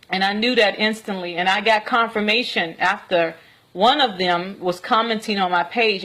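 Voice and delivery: ordinary talk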